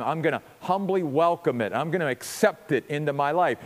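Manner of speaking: ordinary talk, nothing marked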